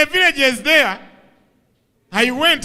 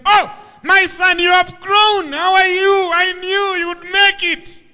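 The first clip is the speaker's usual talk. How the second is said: gushing excited lady; 8 semitones higher than usual. Retold or imitated. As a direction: sing it out like a delighted old auntie. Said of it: "the same lady met us and said".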